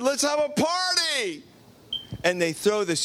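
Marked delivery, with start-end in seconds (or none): 0.0-1.4 high, happy announcement; joyful call. Acted. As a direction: call for the party with delight. Vater feiert Heimkehr.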